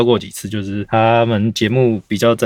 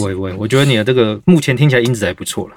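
ordinary talk, nothing marked

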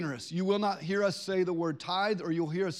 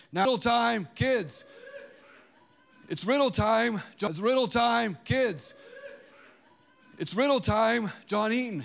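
raised, excited announcement; 4 semitones higher, 5 dB louder than usual. Real, not acted. Host rallies the kids for riddle time, calling out. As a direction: call the room in, high and loud.

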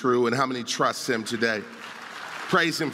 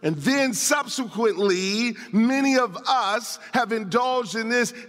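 higher, driving pulpit voice; raised, insistent sermon delivery; impassioned preaching; 7 semitones higher than usual. Real, not acted. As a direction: preach it with urgency, punching key words. Preaches on the passage.

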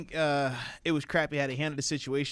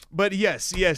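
normal talking voice

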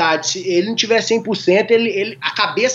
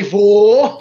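loud, drawn-out VOU; emphatic retold reply, raised volume; louder answer; 2 semitones lower, 6 dB louder than usual. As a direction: say it again with more volume, stretched out. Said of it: "Falei até mais alto".

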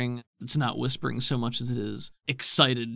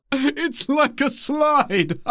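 high, slow, giggly wonder; laughing delight; 13 semitones higher, 6 dB louder, much slower than usual. Acted. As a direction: laugh through it like a kid on a slide.

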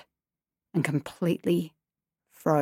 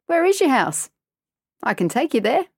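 eager stranger voice; 7 semitones higher than usual. Acted.

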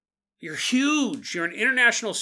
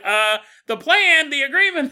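hammed-up impatient reminder; exasperated demon; 10 semitones higher, 10 dB louder than usual. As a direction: spell it out to the forgetful.

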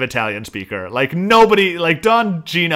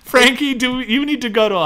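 mock alarmed concern; 12 semitones higher, 4 dB louder than usual. Acting out a worried reaction to Sam.

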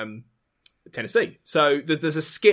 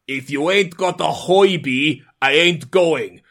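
slow, drawn-out mock German accent; Buddy Hackett's ski instructor Klaus in a German accent; 3 dB louder, slower than usual. Comedian's German ski-instructor punchline in a German accent. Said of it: "he says in a German accent".

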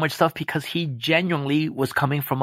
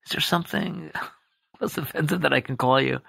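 softer, careful and earnest, imitating the boss; earnest, gentle Michael; 4 dB quieter than usual. Boss gently asking his employee what to call him, softer and earnest. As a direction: ask it gently, as if anything might offend. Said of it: "he was so serious about it".

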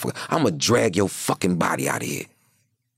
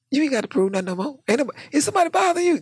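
fast, high-pitched mock question; much faster than usual. Imitating the inmate teasing him, high and fast. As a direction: rattle it off high and quick.

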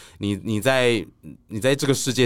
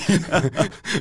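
high laugh; 9 semitones higher than usual. Real, not acted.

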